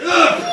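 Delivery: loud, high dying grunt; mock death grunt. Soldier killed by Macbeth, hammed-up death grunt. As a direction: grunt as if struck down.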